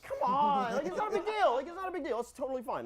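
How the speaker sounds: high, laughing plea that it's no big deal; pleading high. Retold or imitated. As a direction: beg her, voice jumping up high. Pleading with girl about spider.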